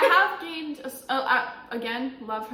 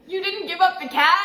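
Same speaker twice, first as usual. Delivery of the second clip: louder, higher, laughing quote of a stranger; quoting with laughter; 8 semitones higher, 9 dB louder than usual. Retold or imitated. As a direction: laugh through the stranger's question. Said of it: "And he goes".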